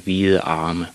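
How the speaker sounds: usual speaking voice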